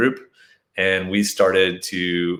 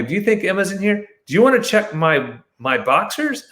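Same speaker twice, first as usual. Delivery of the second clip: much higher, fast, taunting; mocking challenge; 11 semitones higher than usual. Man taunting the searchers at the door, high and mocking. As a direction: dare them to check, mockingly. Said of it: "And I go".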